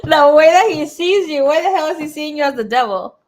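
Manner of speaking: loud, high-pitched disbelief; loud, scandalized reaction; incredulous outburst. Real, not acted. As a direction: exclaim it, astonished and amused. Incredulous at the devil card.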